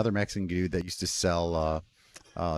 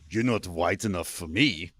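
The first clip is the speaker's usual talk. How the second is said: slow, blunt German friend impression; deadpan German voice, deliberately slow; German buddy; 3 semitones lower, slower than usual. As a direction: imitate a German's matter-of-fact put-down, drawn out. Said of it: "he's German. And he's just like".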